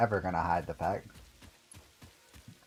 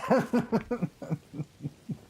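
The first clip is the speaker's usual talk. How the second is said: high laugh; 13 semitones higher than usual. Real, not acted. Laugh at irony.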